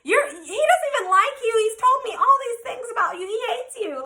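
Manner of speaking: high-pitched mock girl voice; imitating jealous girlfriend. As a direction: mimic her taunts in a high voice. Imitating rival girl's insults.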